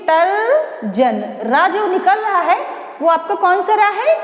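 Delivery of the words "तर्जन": drawn-out syllables to show the r sound; slowly sounded out. Shown as a demonstration. Teacher slowly sounding out तर्जन for students. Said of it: "बोल के देखिए".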